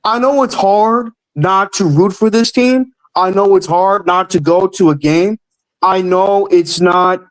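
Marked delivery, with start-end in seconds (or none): none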